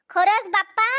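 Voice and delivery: usual speaking voice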